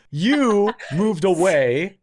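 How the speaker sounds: drawn-out, emphatic mock accusation; half-speed teasing indictment; slow, teasing accusation. Real, not acted. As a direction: spell it out slowly, pointedly.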